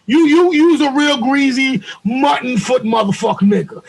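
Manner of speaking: high-pitched, hammed-up angry rant; mock insult tirade. Acts out a hypothetical insulting tirade.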